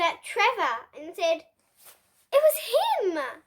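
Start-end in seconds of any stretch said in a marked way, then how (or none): 2.3-3.4 higher, louder blaming cry; accusing shout. A narrator's reading. Pig blaming Trevor for his fart, accusing cry. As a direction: point the finger, loud and high.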